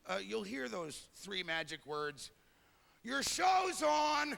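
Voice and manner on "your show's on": high, slow holler; long high call across the house; calling to a spouse. Acted. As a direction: sing it out loudly from another room.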